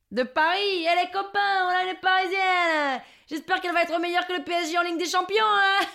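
high, hollered southern mockery; rally your mates to tease the newcomer; teasing Marseillais local. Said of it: "Les gens nous hurlent". (Marseillais moqueur qui ameute les copains)

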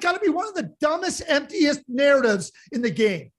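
real exasperated outburst, voice cracking; ornery rant. Real, not acted. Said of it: "[name] is ornery".

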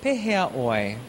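"Pehea oi" said slowly and clearly, low; Hawaiian greeting. Shown as a demonstration. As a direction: model "Pehea oi" for the learner to repeat. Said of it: "and then you say".

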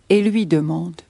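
usual speaking voice

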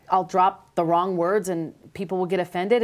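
normal talking voice

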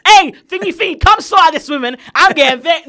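loud, high shout to a colleague; vexed call-out. Retold or imitated. Retail worker calling a colleague to take over, vexed. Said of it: "I had to call my colleague".

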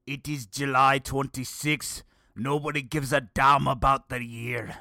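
gruffly hammed-up Scottish accent, loud and slow; exaggerated cranky Scottish brogue; mock Scottish accent. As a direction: put on an angry Scot, loud and drawn out. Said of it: "The Scottish voice would definitely be".

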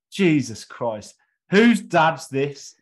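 slow, loud imitated jibe; mock exasperation. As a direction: drag it out as a sarcastic joke. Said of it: "as a joke".

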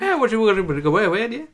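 fast, lightly voiced Irish nan accent; thick Irish accent. Imitating his Irish nan's thick accent, rushed and high. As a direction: rattle it off like an old Irish nan. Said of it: "Like that, you know".

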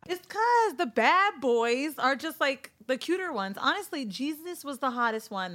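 loud teasing. Real, not acted. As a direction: boom out the punchline. Cohost jokes provocatively loud.

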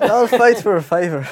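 put-on high, loud Irish accent; Conor McGregor. Podcaster plays the fighter for laughing friends, Irish accent. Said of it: "put on his accent".

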